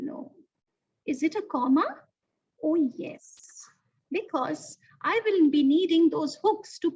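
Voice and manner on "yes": ordinary talk, nothing marked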